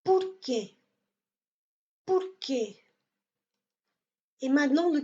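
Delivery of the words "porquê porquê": porquê with weight on falling QUÊ; final-syllable stress. Shown as a demonstration. Teacher models porquê, stress on accented final E. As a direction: lean on the last syllable of porquê. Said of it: "il a un accent sur le E".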